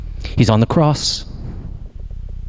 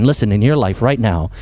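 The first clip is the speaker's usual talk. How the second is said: ordinary talk, nothing marked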